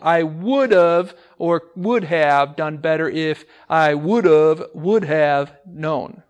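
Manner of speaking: "would have known" stretched out slowly; slow example read. Shown as a demonstration. Teacher reads the trick example slowly to the class. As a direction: read it out slowly, weighing each form. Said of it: "It's actually both would have".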